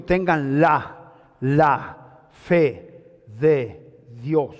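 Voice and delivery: very slow, low and drawn out; slow emphasis. Shown as a demonstration. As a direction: stretch each word so it sinks in. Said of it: "Por eso Jesús dijo". (muy lento y grave, cada palabra alargada)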